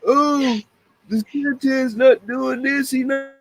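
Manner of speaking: imitating a whiner's complaint, a touch drawn out; mock whine. Mocking people who whine about racism, in a mock whine. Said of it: "cry and whine about".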